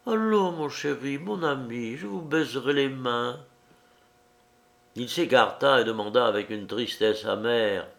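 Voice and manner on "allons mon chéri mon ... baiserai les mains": soft, pleading woman begging her lover. Acted. Liuba softly imploring the man who pulls away. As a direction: beg him gently, arms held out. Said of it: "et elle implora".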